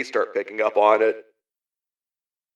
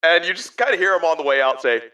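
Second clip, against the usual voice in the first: normal talking voice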